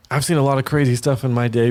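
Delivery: normal talking voice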